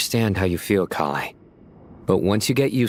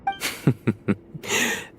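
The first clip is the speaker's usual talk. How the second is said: soft laugh; 3 dB quieter than usual. Acted. Reacts to Tighnari's joke.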